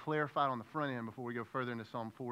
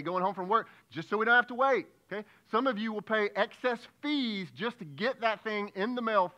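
loud, high, driving sermon voice; preaching at full force; 5 semitones higher, 10 dB louder than usual. Acted. Preacher rousing the congregation on waiting, loud and high.

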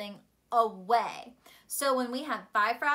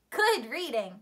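usual speaking voice

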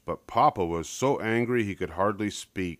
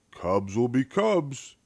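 slow, shrugging father voice; dad shrugging it off to Mama; a hint of Papa Bear; 5 semitones higher, slower than usual. A narrator's reading. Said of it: "he said".

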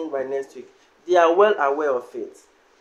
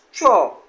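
"throw" with a stressed, falling O; stressed final O; 4 dB louder than usual. Shown as a demonstration. Teacher showing learners the word for pass, stressed O. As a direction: lean on the O in "throw", letting it fall. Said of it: "place stress on the O".

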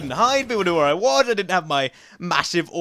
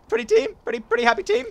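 much higher, cutesy sing-song; silly high voice. Pretend. Gamer cooing to teammates in silly high voice. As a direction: coo it like a cartoon.

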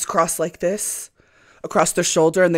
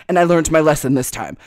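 ordinary talk, nothing marked

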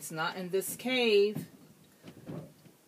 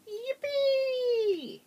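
high, squeaky little mouse cheer; 4 dB louder than usual. A narrator's reading. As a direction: cheer like a tiny excited mouse. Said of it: "The mouse said".

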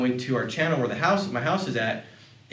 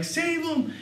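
tiny frightened plea. Retold or imitated. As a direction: imitate a three-year-old begging for help. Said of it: "tugging on my leg".

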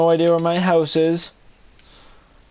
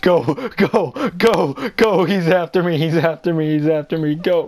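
panicked, pleading run; frantic gasping plea while fleeing; breathless fright; 4 semitones higher than usual. Real, not acted. Fleeing a maniac in game.

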